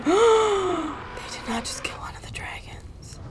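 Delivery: slow, hushed disbelief; stunned whisper. Real, not acted. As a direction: whisper it in shock, slowly. Stunned at dragon being killed.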